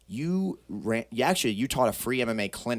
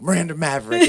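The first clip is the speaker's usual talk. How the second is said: imitating a proud dad, loud and high, laughing; 7 semitones higher, 10 dB louder than usual. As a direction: announce the name like the proudest father alive. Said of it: "And he's like".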